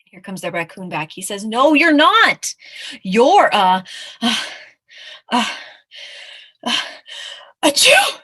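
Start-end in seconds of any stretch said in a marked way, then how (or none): 1.4-2.5 loud, high, slow denial; exasperated storybook raccoon, raised voice; contradicting raccoon. Acted. 3.0-8.2 halting gasps bursting into a loud high sneeze; hammed-up sneeze cutting off the sentence; building sneeze. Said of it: "So he sneezes".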